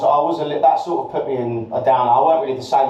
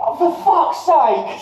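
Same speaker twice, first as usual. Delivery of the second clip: high, drawn-out exasperation; exasperated retold outburst; 14 semitones higher, slower than usual. Learning his friend has fled, retold in drawn-out exasperation. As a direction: stretch it out high in mock despair. Retold reaction to being abandoned.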